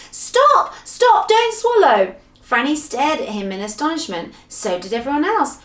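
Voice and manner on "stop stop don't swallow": high, loud panicked yell; urgent, alarmed shriek of warning; frantic yell. Acted. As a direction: shout to stop someone at once. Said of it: "yelled Moonface".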